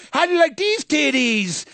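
put-on high, slow, sultry Colombian accent; mocking Sofia Vergara in a Colombian accent; Sofia Vergara impression. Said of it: "she would go".

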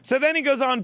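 normal talking voice

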